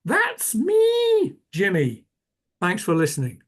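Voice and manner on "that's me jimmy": loud, very high mock Scottish sign-off; exaggerated Scottish accent, pitched way up; attempted Scottish accent. Shown as a demonstration. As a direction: belt it out in a hammed-up Scottish accent. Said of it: "in my attempt at the Scottish accent".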